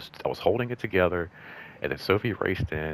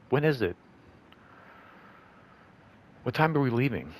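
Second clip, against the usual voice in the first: higher-pitched mock kid questions, long gaps between; imitating pestering kids; 8 semitones higher than usual. Kids pester about a far-off spring trip, mock higher-pitched questions. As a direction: pipe up like a pestering student.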